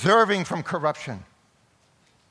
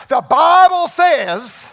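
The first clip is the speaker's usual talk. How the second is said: put-on southern preacher accent, much higher, louder and slow, laughing through it; Billy Graham southern accent; 12 semitones higher, 13 dB louder, much slower than usual. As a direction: boom out "the Bible says" in a southern preacher accent, slow and loud. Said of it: "I have my little southern accent".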